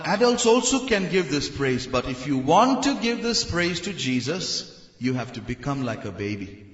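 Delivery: usual speaking voice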